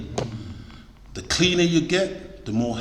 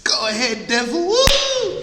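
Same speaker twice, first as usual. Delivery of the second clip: mock excitement. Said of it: "some of us are excited".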